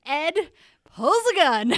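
excited, high-pitched yell; big loud dramatic reveal; giant capital letters. Real, not acted. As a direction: shout it like a headline in all caps. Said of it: "I have it in giant capital letters".

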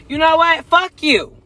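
high, loud fuck you; angry outburst. Real, not acted. Cursing out church folks, high and heated. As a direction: curse them out, heated.

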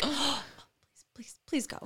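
mock overly polite whisper. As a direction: urge them ahead in a hushed voice. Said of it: "Now I'm like".

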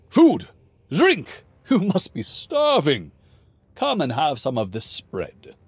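high, loud, delighted outburst; excited king. Acted. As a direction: burst out with sudden hospitality. King excited about food.

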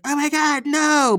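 high, loud, overacted horror; hammed-up gasping dismay; mock shock. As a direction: cry out like a horrified audience. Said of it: "is, like, in shock".

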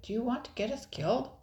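fast, high-pitched scolding question; reprimanding. A narrator's reading. Brother scolds Nicole for provoking pirates, reprimanding. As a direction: scold in alarm. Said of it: "Jasper reprimanded".

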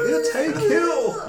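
high, slow call, laughing through it; a high, laughing cry of recognition; mock yell. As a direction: shout it like a sudden realisation. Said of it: "it came to her as I was walking".

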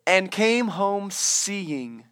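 very slow, each word weighed; slow emphasis. Real, not acted. Preacher slowing to stress the last four words. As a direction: let the last four words land slowly. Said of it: "listen to these last four words".

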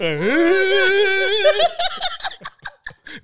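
very high, long fake wail; mock crying. Comic bursting into conversations, hammed-up crying. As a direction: bawl like an overdramatic singer. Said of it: "crying in the middle of conversations like [name]".

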